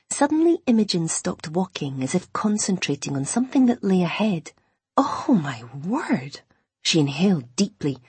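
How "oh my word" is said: slow, drawn-out swooning amazement; awed wonder. A narrator's reading. Imogen catches an incredible scent, slow and awed. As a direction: stretch the words in wonder.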